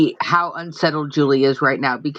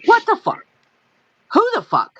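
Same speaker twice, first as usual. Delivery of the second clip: incredulous. Real, not acted. Incredulous outbursts after story.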